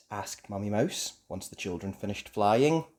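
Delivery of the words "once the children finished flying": ordinary delivery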